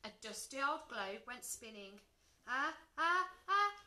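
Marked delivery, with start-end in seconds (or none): 2.5-3.7 high, loud gasps building to a sneeze; held-back sneeze. A narrator's reading. Dragon trying not to sneeze, gasping in bursts. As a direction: suck in as if about to sneeze. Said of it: "trying back to hold a sneeze".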